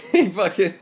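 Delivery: words broken by laughter; laughing through it. Real, not acted. Cracking up over a joke.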